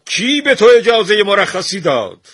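loud, high-pitched imperious yell; angry shout. A narrator's reading. Shah calls after the departing painter, shouting. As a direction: shout after someone walking out on you. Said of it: "شاه فریاد کشید".

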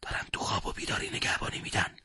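hushed, voiceless aside; secretive whispered remark; quiet whisper. A narrator's reading. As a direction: whisper it so the patrol can't hear. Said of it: "محمد آهسته گفت".

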